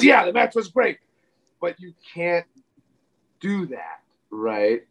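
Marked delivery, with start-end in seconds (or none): 0.0-1.0 a higher, put-on boss voice saying it's fine; playing the boss who was worried, not angry; Vince's reply. Retelling the boss's answer after the match, in a higher put-on voice. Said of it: "And he goes".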